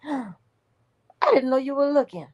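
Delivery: caught parent. Retold or imitated.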